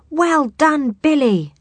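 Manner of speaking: high loud cheer. Acted. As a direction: cheer him on. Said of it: "they cried".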